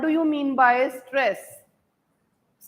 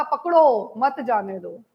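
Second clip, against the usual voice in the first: पकड़ो set apart, then मत जाने दो falling lower; pause after पकड़ो; 3 semitones lower, slower than usual. Shown as a demonstration. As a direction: stress पकड़ो alone, then join मत to जाने दो. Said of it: "degree of force का यूज़ करके".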